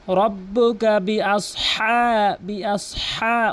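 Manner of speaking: বিআসহা with a throaty ha, held long; বিআসহা recited with a long breathy-throat ḥā; correct drawn-out ha. Shown as a demonstration. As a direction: slowly stretch the last vowel of বিআসহা. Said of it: "হাকে একালিফ টেনে বলতে হবে".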